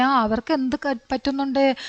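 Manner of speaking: usual speaking voice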